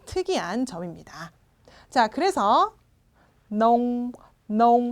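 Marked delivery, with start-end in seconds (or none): 3.5-4.9 농 held into a sealed-lip hum; lips closed at end. Shown as a demonstration. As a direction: model 농 alone, closing the mouth on the end. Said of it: "하고 읽어주시는 거죠".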